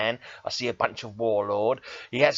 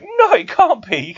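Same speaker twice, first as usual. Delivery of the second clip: high, loud, slow pleading disbelief; drawn-out high anguished denial; stunned denial; 7 dB louder, slower than usual. Retold or imitated. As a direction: beg it not to be true. Disbelief at hero's death.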